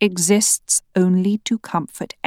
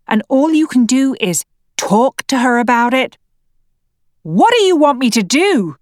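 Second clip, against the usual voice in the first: higher, louder furious rant; angry disbelief; 5 semitones higher, 3 dB louder than usual. Acted. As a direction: pile on the accusations in higher, louder outrage. Wife berating husband in quarrel.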